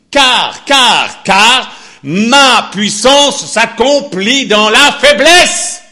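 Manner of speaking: loud, high, slow pulpit proclamation, each car hammered; fiery preaching. Acted. Preacher thundering God's answer to Paul, loud and slow. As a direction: thunder God's promise to the church.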